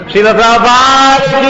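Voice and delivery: rousing temple call; loud devotional call. Real, not acted. Call for the congregation's jaya.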